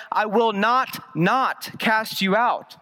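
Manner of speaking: both nots stressed, drawn out slowly; slow double negative. Shown as a demonstration. Pastor to congregation, showing the Greek double negative, slow and stressed. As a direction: lean on each "not" in "I will not, not cast you out" as a Greek emphatic. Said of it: "It would be a double negative".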